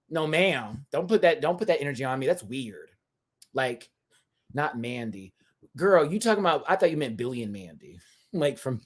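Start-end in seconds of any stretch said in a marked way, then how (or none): none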